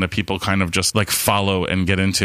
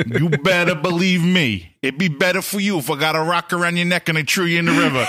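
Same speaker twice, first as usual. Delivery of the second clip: put-on higher-pitched New York mobster accent; Sopranos mafia; 7 semitones higher than usual. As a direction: threaten in hammed-up mobster accent. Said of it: "like, a Sopranos mafia moment".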